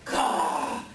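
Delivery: a harsh breathy raven croak; raspy bird croak. Acted. Raven croaks while agreeing with the elder. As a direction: croak like a raven clearing its throat.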